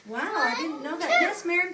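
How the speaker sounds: usual speaking voice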